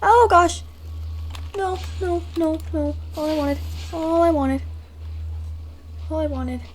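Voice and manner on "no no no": ordinary talk, nothing marked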